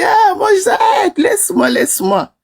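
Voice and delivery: high, pleading protest fading down; mock pleading protest; retold protest. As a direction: push him away in a high voice. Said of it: "J'ai dit".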